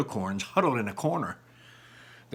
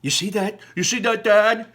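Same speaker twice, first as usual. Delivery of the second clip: bright, higher and louder excitement; impressed; 10 semitones higher, 8 dB louder than usual. Acted. Barley impressed, showing Dad the stone, excited. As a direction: show him you're thrilled by the trick.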